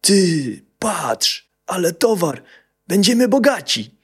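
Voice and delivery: high, altered voice gloating; greedy bandit. A narrator's reading. Bandit gloating to his mate over the goods.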